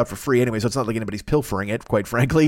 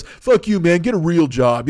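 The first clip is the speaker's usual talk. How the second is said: mock sneer; 4 semitones higher, 4 dB louder, slower than usual. Client sneering at artist.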